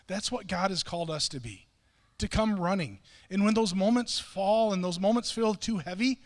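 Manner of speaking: normal talking voice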